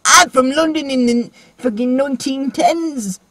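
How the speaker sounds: put-on high, drawn-out old-time London voice; hammed-up period English accent, slow and high; mock English accent. Imitating the girl from London, exaggerated English accent. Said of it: "she started off with the English accent".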